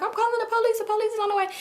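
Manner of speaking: high-pitched imitation of a grandma calling out; mock alarmed grandma. Grandma warning the crowd about police, high mock alarm.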